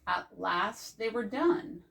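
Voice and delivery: plain reading voice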